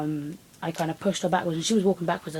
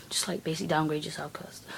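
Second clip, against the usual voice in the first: hushed whispered aside; 5 dB quieter than usual. Real, not acted. Telling viewers a muttered aside, whispered. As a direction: drop to a secret whisper.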